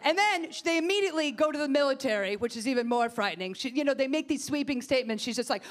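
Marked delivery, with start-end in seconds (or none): none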